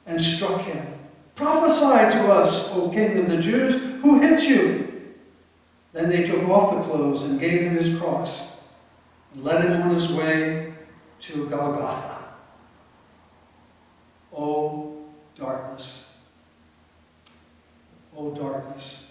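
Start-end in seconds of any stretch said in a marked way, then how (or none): 1.3-4.9 taunting soldiers. Acted. 14.4-19.0 low, breathy sigh of grief; a narrator lamenting under his breath; hushed lament. A narrator's reading.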